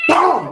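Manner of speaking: loud, high shouted "boom"; explosive mimicked crash; impact noise. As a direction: punch out a big slam noise. Acting out a wrestling-style slam.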